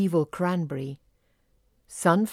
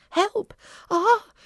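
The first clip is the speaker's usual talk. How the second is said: high, loud little shrieks for help; scream; 6 dB louder than usual. A narrator's reading. Fairies caught by the evil cranberry, screaming. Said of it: "screamed the fairies".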